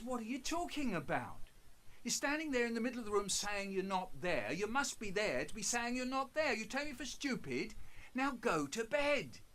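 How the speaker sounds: scolding mum. Retold or imitated. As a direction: tell him off, loud and high.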